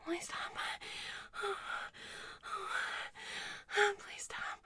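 faint whispered plea; spent, whispered begging. Acted. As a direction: barely voice it. Fading whispered pleas.

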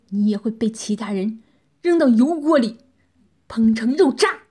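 bitter threats building to a loud snarl; cold cursing. A narrator's reading. Trampled captive refusing to submit, cursing his captor bitterly. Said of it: "口中冷冷地骂道".

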